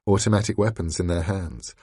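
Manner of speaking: plain reading voice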